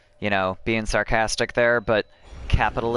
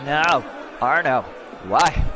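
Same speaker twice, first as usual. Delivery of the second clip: dismayed shout; 5 semitones higher than usual. Real, not acted. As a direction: yell in sudden alarm. Game mishap, shouting.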